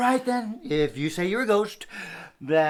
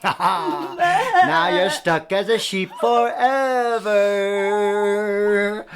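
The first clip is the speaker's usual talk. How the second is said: gloating; 5 dB louder, much slower than usual. Pretend. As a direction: gloat slowly over the victim. Gloating slow declaration.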